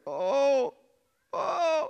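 re-enacted drawn-out groan. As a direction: groan "oh", pitch rising on the second. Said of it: "all I could do was groan".

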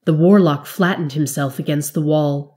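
ordinary delivery, nothing marked

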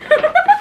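a sharp high giggle. Real, not acted. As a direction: crack up at the brother joke. Laughing at own joke.

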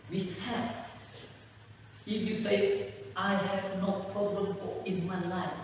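ordinary talk, nothing marked

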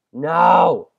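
sudden high yelp of dismay; real frustrated shout. Real, not acted. Gamer yelling as she misses the jump, dismayed shout. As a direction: cry out as the jump fails. Falls in parkour game.

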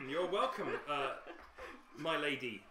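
loud, eager bowing welcome; fawning bartender. Acted. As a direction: greet the noble with over-eager deference. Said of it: "he says".

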